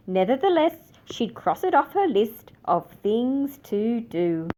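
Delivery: usual speaking voice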